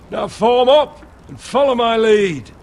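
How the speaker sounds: commanding order. Acted. Officer orders new brothers to follow him, commanding order. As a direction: call the men into line.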